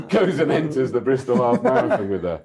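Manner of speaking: laughing. Real, not acted. Self-mocking race story.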